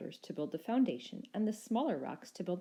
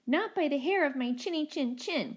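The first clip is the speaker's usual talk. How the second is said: much higher, squeaky defiant call; high cheeky little dino call; 9 semitones higher, 5 dB louder than usual. A narrator's reading. Second little dino calling out defiantly to the Rex, high and cheeky. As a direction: call out like a small plucky creature. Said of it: "called out the second little dino".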